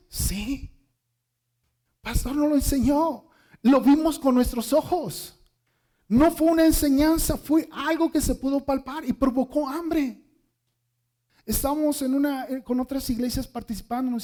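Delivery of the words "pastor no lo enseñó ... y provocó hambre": loud, emphatic preacher's voice; preaching. Acted. Pastor testifying to the church, louder and emphatic. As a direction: preach it to the congregation with fire.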